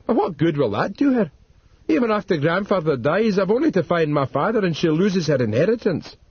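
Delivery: put-on young Lockhart, arguing. A narrator's reading. Lockhart questions Dodd about inheritance, earnest.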